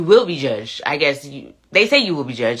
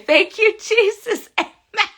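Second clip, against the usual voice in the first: much higher, laughing through "thank you Jesus, amen"; laughing praise; 3 dB louder, slower than usual. Real, not acted. Cracking up at mama's comment, laughing praise.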